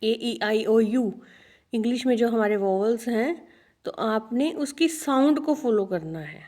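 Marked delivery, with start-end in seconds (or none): none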